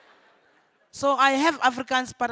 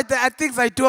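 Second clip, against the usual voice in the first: ordinary talk, nothing marked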